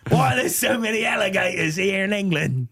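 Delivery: high, hammed-up English with Aussie slips; fake English accent. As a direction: wail the question in a bad English accent. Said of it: "sounds a little bit more English".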